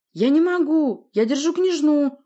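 loud, high, frantic protest; strained, high yell; shouted objection. Acted. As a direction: protest at the top of the voice. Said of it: "возразил Порти".

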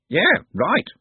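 high-pitched creature retort; bird creature. A narrator's reading. Bird creature answering Trev about the goblin, high-voiced. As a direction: throw it back in a high bird voice.

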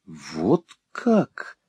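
hushed, taken-aback exclamation; surprised exclamation. A narrator's reading. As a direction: react with quiet surprise. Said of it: "воскликнула мисс Лакриви".

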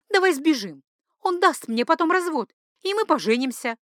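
high-pitched impassioned plea; ardent, feverish. A narrator's reading. Mistress urges her lover to elope, feverishly ardent. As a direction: push it out ardently, high and fairly loud. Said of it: "говорила так быстро и пылко, словно бредила".

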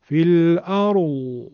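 slow "الارض" fading in a long coo, no d stop; dove-like Hijazi Dad. Shown as a demonstration. Teacher repeating the original Dad, drawn-out coo. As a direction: draw the last sound of "الارض" out like a cooing dove. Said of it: "که همون صدای قمری گفتن".

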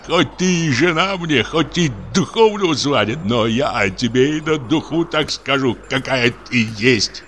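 high, tearful, slowing accusation. A narrator's reading. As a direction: accuse her through tears. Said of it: "глухим, плачущим голосом".

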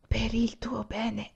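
soft, slow entreaty; insistent plea. A narrator's reading. Olì pleads for Anania's own good, soft insistence. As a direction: insist gently, barely above a murmur. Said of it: "ella insisté".

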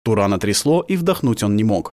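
ordinary delivery, nothing marked

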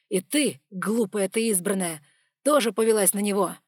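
loud, hard scolding; angry warning. Acted. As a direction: rebuke her for trusting Lukas. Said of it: "dragon's angry warning".

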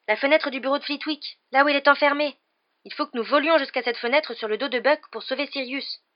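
pressing his plan eagerly; excited Harry. Acted. Harry urges Hermione, excited. (Harry excité, voix plus forte)

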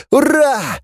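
very high hurrah; triumphant cheer. A narrator's reading. Scientist sees the scout start to shrink, triumphant cheer. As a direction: cheer as the invention works.

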